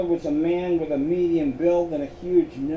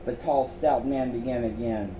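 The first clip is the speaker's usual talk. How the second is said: ordinary delivery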